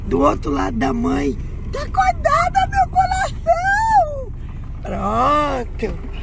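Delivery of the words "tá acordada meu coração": high, slow cooing to a puppy. Real, not acted.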